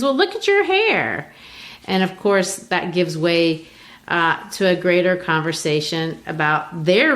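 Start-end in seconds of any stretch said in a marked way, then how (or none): 0.0-1.3 high teasing retort; Assegai teasing. Retold or imitated. Assegai teasing Beneatha about her hair, high playful voice.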